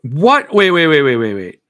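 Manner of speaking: loud, sudden what, then a burst of repeated wait; real shock at a comment, calling out wait, wait; startled surprise. Real, not acted.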